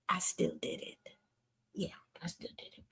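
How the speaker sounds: near-silent sly aside; whispered confession. Real, not acted. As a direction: whisper it like a secret admission.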